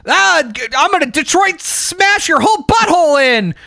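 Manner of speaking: put-on high, excited drunken fan shouting a catchphrase; drunk fan boasting. Drunk fan boasting to friends at a con, high and excited. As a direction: ham it up like a drunk fan at a bar.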